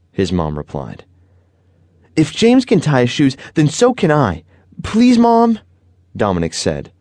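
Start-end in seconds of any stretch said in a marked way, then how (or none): 2.2-5.6 put-on high child's voice rising to a loud, very high please; pleading boy. A narrator's reading. Boy pleading with his mom for tie shoes, rising high plea. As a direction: beg harder on please mom.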